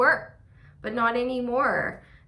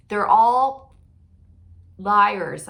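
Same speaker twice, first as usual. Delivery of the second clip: loud, firm on liars; emphatic; 7 dB louder, much slower than usual. Real, not acted. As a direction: punch it out.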